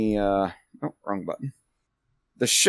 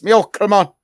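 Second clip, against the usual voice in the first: loud, high call in a bad accent; Grimnir; 9 semitones higher, 6 dB louder, faster than usual. Grimnir calling his sheep after him, loud bad accent. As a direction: call out in a deliberately terrible accent. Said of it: "Grimnir's accent is terrible".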